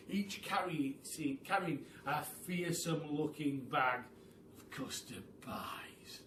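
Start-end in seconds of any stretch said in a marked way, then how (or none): 4.6-6.2 hushed ominous whisper. A narrator's reading. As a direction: drop to a whisper. Ominous end.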